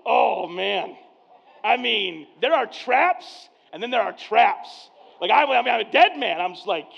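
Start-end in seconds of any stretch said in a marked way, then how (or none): 0.1-1.0 a cornered dad's loud groan; caught out groan. Retold or imitated. Dad cornered by his daughter's question, loud groan. 2.4-6.4 loud, slowed dramatic dread; mock panic. Real, not acted. Pastor telling congregation how his daughter trapped him, loud mock panic.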